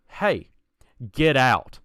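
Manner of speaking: lightly voiced ghost order. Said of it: "And the ghost goes".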